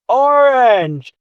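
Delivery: put-on high, loud child's shout; very young voice. A young child answering the teacher, high young voice. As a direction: call out like an eager little pupil. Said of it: "you hear a very young voice go".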